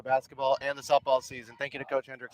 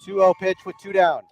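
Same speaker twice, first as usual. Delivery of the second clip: play-by-play; 3 semitones higher, 6 dB louder than usual. Acted. Live softball play-by-play.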